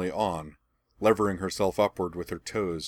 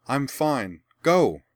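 calling out; 6 semitones higher, 5 dB louder, much slower than usual. A narrator's reading. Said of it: "Rian called to her".

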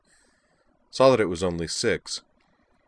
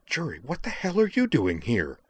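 high, urgent demand, held in; hushed but urgent; 7 semitones higher than usual. A narrator's reading. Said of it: "in a hushed but urgent tone".

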